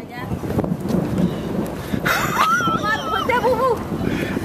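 high, delighted giggle; high giggling laugh. Real, not acted. Wife watching the clip breaks into a high giggle.

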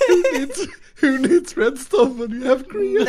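high, laughing through the joke. Real, not acted. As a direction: crack up mid sentence. Joke while cracking up.

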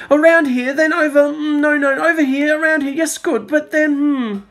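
lost guide arguing with himself over the map; dithering guide. A narrator's reading. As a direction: fuss back and forth over the route. Guide ignores Judy, keeps dithering.

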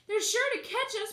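put-on high, loud breathless girl; girl panting as she flees. A narrator's reading. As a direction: gasp it out while running. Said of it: "panted the girl".

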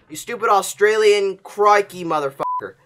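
ordinary talk, nothing marked

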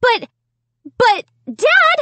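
high-pitched, flustered stammering protest; sputtering. A narrator's reading. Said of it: "I sputtered".

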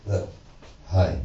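soft shy hello; timid greeting. Retold or imitated. Comic mimicking the sincere intro his wife wants, soft and shy. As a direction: say it meekly. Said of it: "She wanted me to come out and say".

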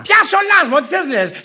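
high-pitched, loud protest; exasperated outburst. Acted. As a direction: shout it back in exasperation. Husband exasperated in quarrel. (αγανακτισμένη φωνή)

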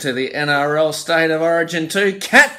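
loud, bright sing-song host opening; announcer intro. Acted. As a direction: kick off the comp like a TV host. Host opens the tipping competition.

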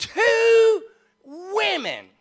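high, loud, drawn-out announcement; slow, booming punchline with pitch way up; dramatic reveal. Real, not acted. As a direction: stretch each word like a showman's reveal. Big reveal for the congregation.

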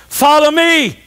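high, ringing preacher's command; commanding call. Acted. Declaims Jesus' command loudly.

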